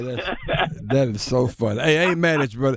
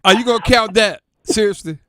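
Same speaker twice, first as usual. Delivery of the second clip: incredulous teasing; 8 semitones higher, 8 dB louder than usual. Real, not acted. Teasing disbelief at a boast.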